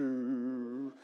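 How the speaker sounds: usual speaking voice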